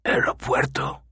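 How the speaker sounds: unvoiced menacing whisper; whispered question. A narrator's reading. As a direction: breathe the word into his ear. Killer repeating into his ear.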